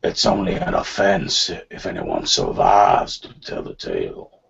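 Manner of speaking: very low, slow lawman's threat; menacing sheriff. Acted. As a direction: drop right down into a menacing sheriff voice. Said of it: "you see the sheriff".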